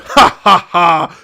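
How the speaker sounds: hammed-up villain ha ha ha; mock evil chuckle; mock villain laugh. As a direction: laugh like a cartoon baddie. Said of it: "Prime's like".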